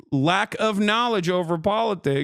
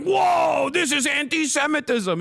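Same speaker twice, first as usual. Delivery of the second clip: mock indignant whine; 8 semitones higher than usual. Mocking Ethan's outraged reply, high and hammed-up. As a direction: play a shocked, offended streamer over the top.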